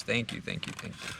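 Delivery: soft, fading whisper; whispered thanks. Real, not acted. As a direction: breathe the thanks out quietly.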